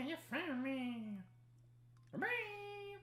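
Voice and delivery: very high squeaky chipmunk voice; sped up, squeaky and high; helium voice. Pretend.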